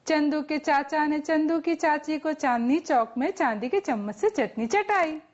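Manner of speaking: quick, unbroken string of words; fast tongue twister. Shown as a demonstration. Teacher showing students another one, rapid tongue twister. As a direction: rattle the whole line off in one go. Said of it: "એક બીજું બી છે આવું".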